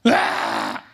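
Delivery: very low, loud growl; guttural demon groan. Retold or imitated. Possessed man reacting, low loud demonic groan. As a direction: groan from deep in the belly. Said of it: "sabi ng demon poseso".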